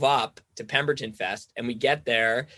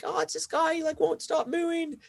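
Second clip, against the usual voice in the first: much higher, hammed-up young woman's voice; imitating a Kiwi girl. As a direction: squeak it out like the girl from the next tent. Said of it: "this Kiwi girl pops out of her tent".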